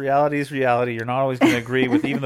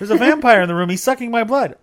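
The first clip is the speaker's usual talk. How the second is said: high, loud pretend panic; mock child alarm; 9 semitones higher, 7 dB louder than usual. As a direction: cry out like an alarmed kid, hammed up.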